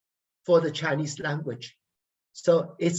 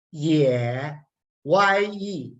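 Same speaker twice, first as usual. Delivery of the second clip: ordinary talk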